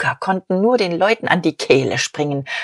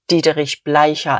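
ordinary delivery

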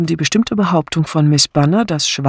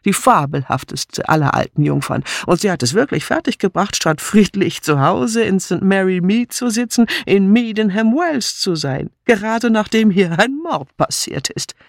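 high, animated delight; excited gushing in a raised pitch; excited exclamation; 6 semitones higher than usual. A narrator's reading. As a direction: burst out with delighted recognition. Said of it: "rief Sir Henry".

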